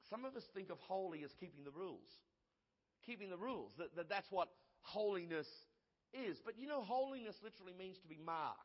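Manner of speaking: usual speaking voice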